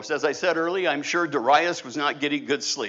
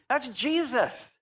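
ordinary talk

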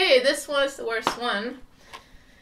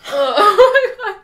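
laughing groan; 9 semitones higher, 15 dB louder, slower than usual. Real, not acted. After the vomit bean.

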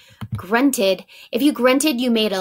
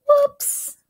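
surprised whoops; 4 dB louder than usual. Real, not acted. Teacher slips mid-sentence.

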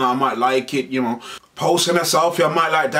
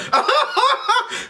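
high laugh. Real, not acted.

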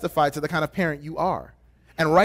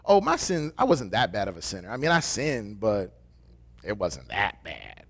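self-excusing sinner; 8 semitones lower, 3 dB quieter than usual. Retold or imitated. Sinner fooling himself.

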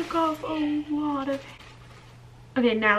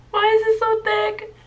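much higher, louder alarm; high panicked exclaim; 10 semitones higher, 7 dB louder than usual. Real, not acted. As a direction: shriek it in panic. Panicked exclamation cutting wig.

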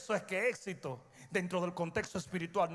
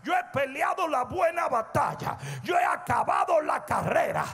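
higher, louder climactic shout; fiery preaching; 7 semitones higher, 5 dB louder than usual. Acted. Preacher proclaiming Paul's victory to the church, shouting. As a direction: preach the apostle's triumph at full force.

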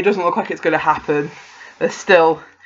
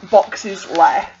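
normal talking voice

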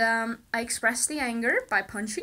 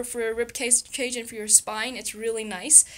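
normal talking voice

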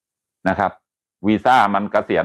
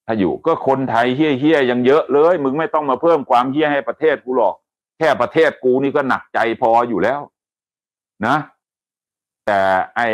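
higher, harsh telling-off aimed at the foreigner; scolding rant; 5 semitones higher than usual. Real, not acted. Host scolding the violent foreigner on air, angry rant. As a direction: berate him like he's in front of you.

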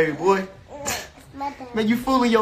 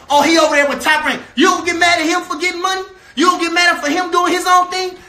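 mock outraged shout; 6 semitones higher than usual. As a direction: throw their complaint back at them in a shout. Mocking critics' complaints, shouting.

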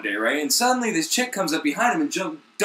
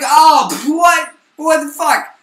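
high, loud shocked shout; shocked outcry; 12 semitones higher, 11 dB louder, slower than usual. Retold or imitated. Disciples shouting in disgust at the bread, shocked. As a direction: yell it in disgusted disbelief.

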